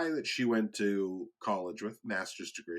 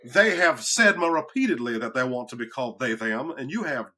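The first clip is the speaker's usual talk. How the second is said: Foghorn Leghorn; 2 semitones higher, 5 dB louder than usual. Acted. Defending a colleague's pronouns in a cartoon rooster impression. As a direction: scold like a cartoon Southern rooster.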